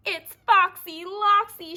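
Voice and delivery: high, loud, slow shriek; frightened shriek. Acted. Chicken Little recognizing the fox, loud high shriek. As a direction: shriek the alarm. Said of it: "she shrieked".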